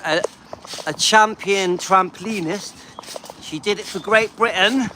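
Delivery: normal talking voice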